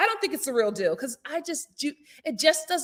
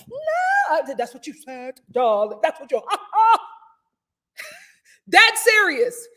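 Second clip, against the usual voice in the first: mock posh accent; 5 semitones higher, 5 dB louder than usual. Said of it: "Every sentence was a different accent".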